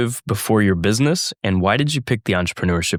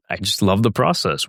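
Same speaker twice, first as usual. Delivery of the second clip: ordinary talk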